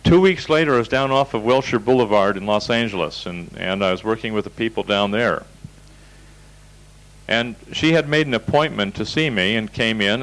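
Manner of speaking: ordinary talk, nothing marked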